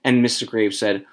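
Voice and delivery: plain reading voice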